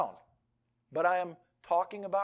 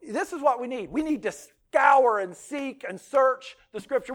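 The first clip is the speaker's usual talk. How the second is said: normal talking voice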